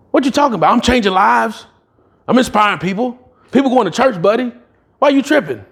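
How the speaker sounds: high-pitched, defensive disbelief; indignant pushback. Retold or imitated. Arguing back at the pastor.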